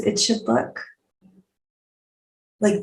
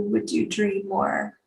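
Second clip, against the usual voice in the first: usual speaking voice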